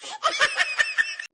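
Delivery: high sneering cackle; mocking laugh. Mother-in-law cackles at the pizza-eating daughter-in-law. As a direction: cackle in triumph.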